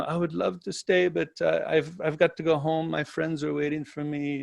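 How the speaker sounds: lightly attempted Italian accent, softly spoken; soft, tentative stab at an Italian accent; imitated Italian accent. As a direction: imitate her cute broken-English Italian accent. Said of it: "she's got this beautiful accent".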